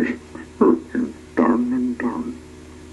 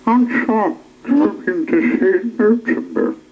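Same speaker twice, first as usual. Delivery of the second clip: high, squeaky little goblin; a small goblin chirping a question; 10 semitones higher than usual. A narrator's reading. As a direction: pitch it way up like a tiny goblin. Goblin voiced in story.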